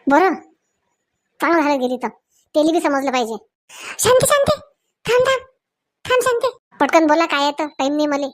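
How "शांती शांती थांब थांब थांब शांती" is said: much higher call to stop her; urgent call. Acted. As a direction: call her back high and hurried. Husband calling wife to stop. (उंच आवाजात थांबवणे)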